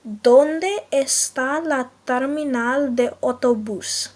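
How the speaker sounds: slow, careful Spanish phrase; teacher modelling Spanish slowly; slow Spanish demo. Shown as a demonstration. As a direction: spell it out slowly for learners. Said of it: "is said as".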